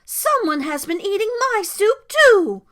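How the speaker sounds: high, loud motherly exclamation; exclaimed indignation. A narrator's reading. Mama Bear finding her soup eaten, exclaiming. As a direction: cry out high and affronted. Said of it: "exclaimed Mama Bear".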